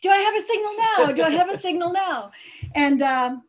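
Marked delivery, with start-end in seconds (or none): 0.0-2.3 higher, louder mock-urgent call; imitated phone searching. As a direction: act out hunting for a signal, raised voice. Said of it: "like, doing".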